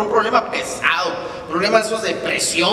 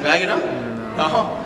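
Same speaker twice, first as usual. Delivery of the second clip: ordinary delivery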